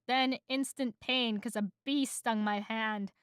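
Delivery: ordinary delivery, nothing marked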